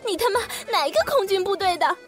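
high, wailing tantrum; crying scream. A narrator's reading. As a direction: scream it out in tears. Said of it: "何木子哇地哭了，喊". (哭喊)

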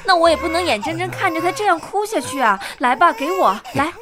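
insist, refusing to just stand by; urgent insistence. Acted. Woman demanding the crying baby from the officer, urgent.

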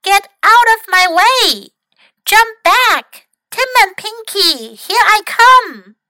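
loud, high shout downhill. Acted. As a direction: call it out as he launches. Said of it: "shouted Mac".

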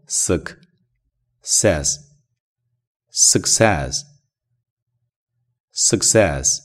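success said very slowly, stress on the back half, suc-CESS; stress on second syllable. Shown as a demonstration. Teacher drilling the word success for listeners, slow and stressed. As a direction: stretch out success, leaning on CESS. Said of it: "这个单词的重音在后半部分".

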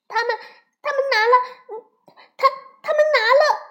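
high, loud stammering little bear; too angry to speak. A narrator's reading. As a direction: stammer it out in a child's furious outburst. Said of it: "气得说不出话来". (气得说不出话的高声童音)

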